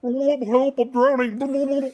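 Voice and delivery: high, loud cry for help, slow; desperate drowning cry, high and loud. Pretend. Passenger role-play drowning in toilet.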